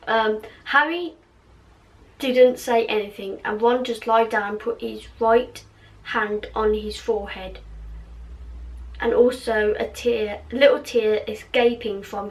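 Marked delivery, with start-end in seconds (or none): none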